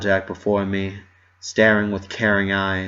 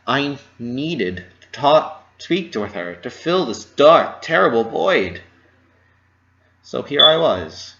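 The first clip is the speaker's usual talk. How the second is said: ordinary delivery, nothing marked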